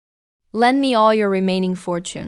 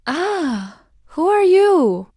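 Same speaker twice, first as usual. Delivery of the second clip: shocked cry; 9 semitones higher, much slower than usual. Acted. Prince recoiling from a stranger in the mirror, shocked cry. Shocked by his changed face.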